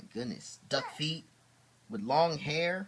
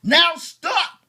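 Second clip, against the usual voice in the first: higher, louder bossy order; commanding Max; 14 dB louder than usual. Acted. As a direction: stop the wild things cold. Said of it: "Max said".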